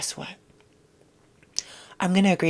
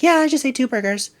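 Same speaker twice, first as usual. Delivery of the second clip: higher, louder casual boast; hammed-up breezy brag; mock proud fat person; 7 semitones higher, 7 dB louder than usual. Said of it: "They'd be like".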